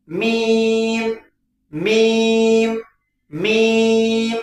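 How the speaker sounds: "मीम" with both lips pressed, drawn out slow; lips-closed letter. Shown as a demonstration. Teacher drills students: slow "मीम" with closed lips. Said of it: "दोनों होंठ आपस में मिलेगा".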